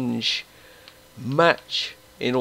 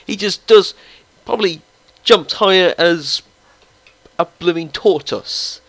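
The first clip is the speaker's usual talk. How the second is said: higher, louder scoff at a useless header; mocking disbelief; 4 semitones higher, 5 dB louder than usual. Real, not acted. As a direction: sneer that he jumps like a tortoise. Mocks player's jumping.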